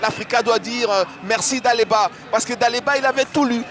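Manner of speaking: loud replay commentary. Real, not acted. Replay analysis over crowd.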